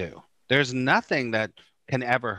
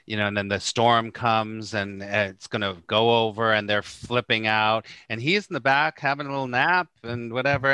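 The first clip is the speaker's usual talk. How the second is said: ordinary talk, nothing marked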